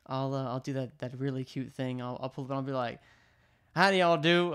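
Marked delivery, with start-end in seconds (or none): 3.7-4.5 put-on Southern accent. Pulling up to the drive-thru, hammed-up Southern accent. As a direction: belt it out like a folksy Southerner. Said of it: "like, a really southern accent".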